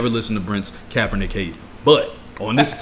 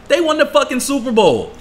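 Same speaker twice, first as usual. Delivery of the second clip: emphatic outburst; 6 dB louder than usual. Real, not acted. Heated football argument punchline.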